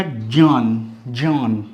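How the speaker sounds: ज्ञान said as jyaan, starting on ज not ग; ज्ञान with ज sound. Shown as a demonstration. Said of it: "अगर मैं इसको ज्ञ पढ़ता हूँ". (ज्ञान को ज्यान की तरह, ज से शुरू करके बोलें)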